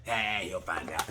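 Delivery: slow, low mock marshal voice. Same marshal line repeated.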